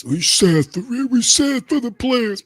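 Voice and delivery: high, slow, whiny mock pity; sarcastic high whine of pity; mock sympathetic voice. Mocking those who pity players.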